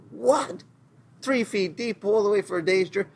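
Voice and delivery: high, startled What? then a mocking disbelief; mock surprise. Preacher imitating the people waking to quail, mock astonished. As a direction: act stunned at the pile of quail, pitch jumping.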